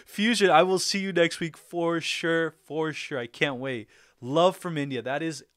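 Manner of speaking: ordinary talk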